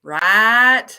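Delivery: loud, long-held Southern vowel; Southern drawl. Shown as a demonstration. Teacher showing Southern vowels to students, loud Southern drawl. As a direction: stretch the vowel in a Southern twang.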